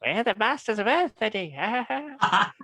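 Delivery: silly high laughing Dalek; Adrian Edmondson Dalek. Retold or imitated. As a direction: ham up a giggling manic Dalek. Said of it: "sounds like Adrian Edmondson".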